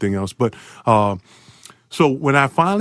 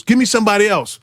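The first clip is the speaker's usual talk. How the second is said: Larry Bird demanding; 7 semitones higher than usual. Retold or imitated. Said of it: "he goes".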